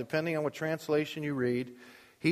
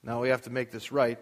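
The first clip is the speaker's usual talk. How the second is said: usual speaking voice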